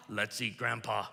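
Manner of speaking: low, quiet, menacing take; sinister quiet. Shown as a demonstration. Preacher jokes Grandpa is dinner, low and menacing. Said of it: "I'll say the exact same words two different ways".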